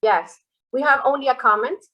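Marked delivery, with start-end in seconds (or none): none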